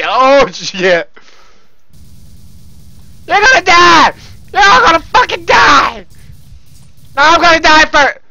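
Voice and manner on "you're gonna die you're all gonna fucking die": high, fierce gamer shouting; threatening yell. Real, not acted. Gamer threatening everyone in the match, threatening yell. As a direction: yell the threat at the enemy team.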